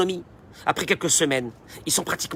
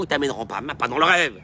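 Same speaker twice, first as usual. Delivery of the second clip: normal talking voice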